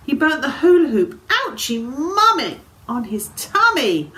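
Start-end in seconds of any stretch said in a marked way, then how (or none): 1.3-2.6 put-on pained childish cry. Burnt dragon cries for mum.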